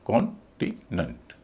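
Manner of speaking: कॉन्टिनेंट split as "kon, ti, nunt"; syllable by syllable. Shown as a demonstration. Teacher sounding it out, syllable by syllable. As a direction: break कॉन्टिनेंट into three, last two light. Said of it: "तो इसको बोला जाएगा".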